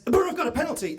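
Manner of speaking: excited call. Retold or imitated. A man leaning out of a car window shouts the radio news, excited. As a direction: pitch jumps way up on "The Borough have got a penalty". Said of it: "and he says".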